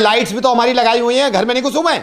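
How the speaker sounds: high, loud-edged prank protest; indignant builder. Pretend. Builder claims right to enter over lights, mock indignant. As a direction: argue back brazenly, pitch pushed high.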